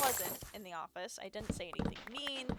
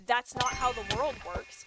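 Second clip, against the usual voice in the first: slow, louder, each word laid down; retold firm retort; 4 semitones higher, 10 dB louder, slower than usual. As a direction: lecture them on how the world works. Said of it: "I responded with".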